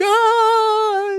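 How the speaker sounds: high excited squeal; mock thrilled high-pitched ah; delighted squeal. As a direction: squeal with joy. Guests' delighted reaction.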